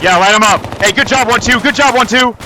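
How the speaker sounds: high, loud excited cheer; excited praise. Real, not acted. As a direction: hype up your squadmate mid-fight. Firefight win, praising teammate.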